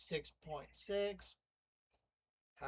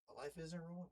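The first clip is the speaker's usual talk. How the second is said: muttered correction; 12 dB quieter than usual. Real, not acted. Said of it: "My Life in Ruins".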